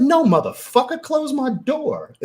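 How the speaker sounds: high, loud indignant yell; outraged shriek, imitating himself; retold scream. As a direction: reenact screaming at an intruder, pitch way up. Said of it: "I screamed and cursed this dude".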